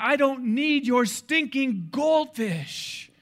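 mock outrage, rising in pitch and stretching the last line; over-the-top indignant tirade; indignant rant. Acts out indignant answer about goldfish.

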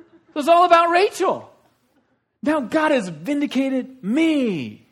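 gloating, high, loud whooping; hammed-up triumphant taunting; Rachel. Rachel rubbing it in her sister's face, loud gloating. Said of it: "Rachel turns to her sister Leah and says".

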